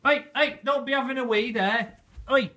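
loud high scolding. Real, not acted. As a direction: shout the puppy off. Scolding puppy.

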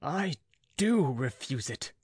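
high, defiant refusal, slowing down; rage. Acted. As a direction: refuse him through barely held rage. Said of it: "I could no longer suppress the rage".

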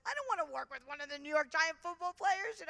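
high, loud pleading protest; mock-frantic plea to the priest; whiny protest. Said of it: "I'm like".

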